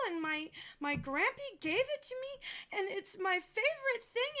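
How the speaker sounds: put-on high, pleading little girl voice; upset little girl. Child tells of stolen marbles.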